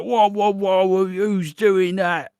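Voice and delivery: high, loud, drawn-out whoa; startled, slowing right down; surprised. Retold or imitated. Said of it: "Jack's like".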